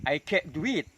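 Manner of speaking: can't with the T stopped, not popped; held final t. Shown as a demonstration. Teacher giving the second version in a sentence, T held in can't. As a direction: swallow the T in can't, straight into "do". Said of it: "dengan cara menahan huruf T".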